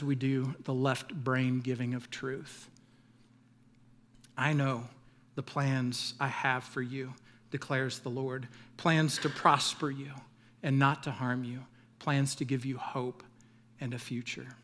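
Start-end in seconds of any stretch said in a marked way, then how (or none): 4.4-14.6 soft, hushed reading of the verse; quiet scripture reading. Acted. Pastor closing sermon reads verse softly to congregation. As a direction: let the promise settle gently.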